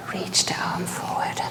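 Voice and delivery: breathy whispered cue. Real, not acted. Yoga teacher whispering a cue to class. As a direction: whisper it gently mid-pose.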